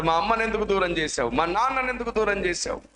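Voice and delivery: put-on kneeling boy cries out to God, tearful and accusing; crying accusation. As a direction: plead with God through tears. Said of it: "మోకరించి ఏడుస్తున్నాడు".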